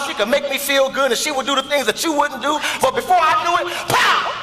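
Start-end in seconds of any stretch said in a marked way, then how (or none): 2.8-4.3 building excitement. Acted. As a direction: build to the punch. Husband climaxes his story.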